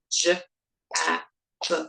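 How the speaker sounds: "jab" split into j, a, b, each separate; segmenting sounds. Shown as a demonstration. Teacher models splitting "jab" into sounds, each alone. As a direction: say j, a, b one by one. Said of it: "let's try it with the word jab".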